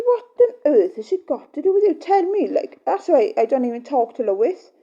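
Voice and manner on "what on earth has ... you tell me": high loud mock-outrage; indignant retort. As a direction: throw the question back, exasperated. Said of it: "And I said".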